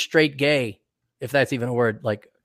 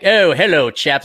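hammed-up British greeting; loud, posh mock-British hello; 5 dB louder than usual. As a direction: put on a jolly English club accent. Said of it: "none of us have British accents".

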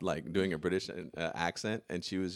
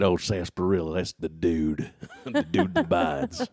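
slow, deep drawling cowboy; 8 dB louder, slower than usual. Retold or imitated. Showing off a Sam Elliott bit, slow deep cowboy voice. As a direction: drag it out low like an old ranch hand. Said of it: "That's a great deep voice".

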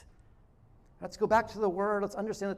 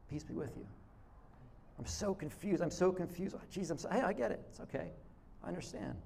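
soft reassurance; 9 dB quieter than usual. Acted. Acting out Jesus calming disciples.

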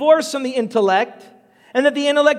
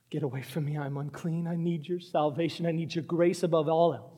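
low, hushed plea; humble confession; 7 semitones lower, 10 dB quieter than usual. Acted. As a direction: pray quietly as an unworthy sinner. Quiet prayer of sinful man.

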